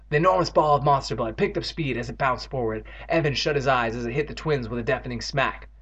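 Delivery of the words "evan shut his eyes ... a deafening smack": usual speaking voice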